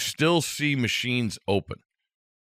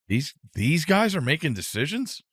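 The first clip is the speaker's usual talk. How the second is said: higher-pitched retold disbelief; incredulous, rising question; 6 semitones higher than usual. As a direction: question it like you can't believe it. Retold disbelief at airport staff.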